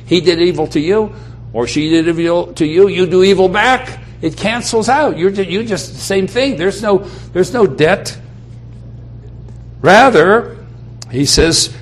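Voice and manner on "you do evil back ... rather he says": normal talking voice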